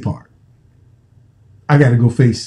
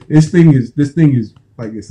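ordinary delivery

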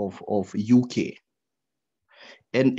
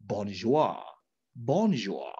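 low bonjour; 7 semitones lower, 5 dB quieter, slower than usual. Shown as a demonstration. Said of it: "you own even the intonation of bonjour".

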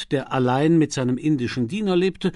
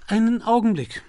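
higher sudden exclamation; little exclamation; 10 semitones higher, 4 dB louder than usual. A narrator's reading. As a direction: stop short with a sudden thought. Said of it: "mit einem kleinen Ausruf". (überraschter kleiner Ausruf)

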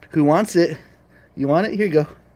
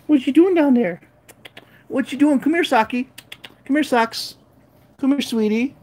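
high, sing-song pet voice; baby talk to cats; 10 semitones higher than usual. Acted. Owner cooing to her cats, high baby talk. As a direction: coo at the cat like a baby.

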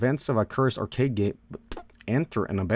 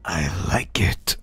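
whisper; 6 semitones lower, slower than usual. Real, not acted. Quiet aside while starting game.

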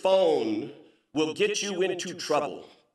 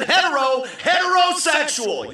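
high, loud blurted announcement; yelled; 12 semitones higher, 7 dB louder than usual. Retold or imitated. Customer repeating himself to the bartender, yelled out. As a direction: shout it to the whole room. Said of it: "Yelled it at the".

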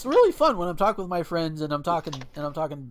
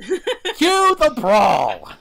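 loud, high, drawn-out announcer call; long gleeful holler through laughter; announcer shout; 9 semitones higher, 11 dB louder, much slower than usual. Acted. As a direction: bellow it like a fight starter. Announces the fight in the story.

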